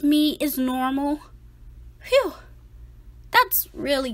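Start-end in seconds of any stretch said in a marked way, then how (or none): 2.0-2.3 high, sharp sigh of relief; relieved phew. Acted. Boy relieved to learn that losing teeth is normal. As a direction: blow out the worry in one high breath.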